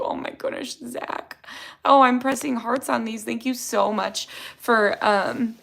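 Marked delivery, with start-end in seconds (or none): 0.0-1.4 touched whisper. Real, not acted. Touched by a viewer's comment.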